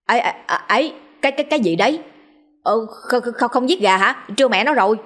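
flustered stammer, repeating words; loud blabbering. Acted. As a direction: blurt it out in startled confusion. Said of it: "cũng phóng theo mà bô bô".

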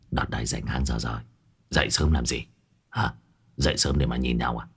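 Hải nghẹo lazy, low. Acted. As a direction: grumble it out low and lazy, barely awake. Hải nghẹo replying lazily. (Hải nghẹo uể oải, giọng trầm và nhỏ)

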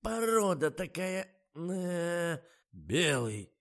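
высокий, громкий голос жалующегося старика; сетует, как старый охранник; ворчливый дед. A narrator's reading. (громкое стариковское ворчание)

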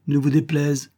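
plain reading voice

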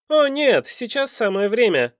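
loud, high-pitched cutting in; intruding villain. A narrator's reading. Михаил cuts into the couple's talk, loud and forceful. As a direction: butt in forcefully, pitched well up. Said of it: "вмешался Михаил Львович".

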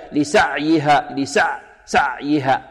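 لسعيها with the ayn choked off abruptly; over-stopped ayn. Shown as a demonstration. Quran teacher imitates students' error, over-stopped ayn. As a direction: cut the sound dead on the ع of لسعيها. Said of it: "قريب من الشدة، يقول هكذا".

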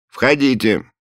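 muffled call from behind a door; through a wall. Acted. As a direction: call from another room. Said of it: "глухо донеслось из-за двери".

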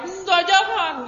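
high, drawn-out mock cry of protest; retold scream. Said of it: "Wah, teriak". (teriakan tiruan bernada tinggi)

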